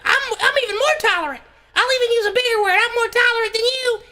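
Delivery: very high squeaky bird voice; one-upping little bird chirp; cartoon bird bragging in falsetto; boastful bird. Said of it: "the third bird's like".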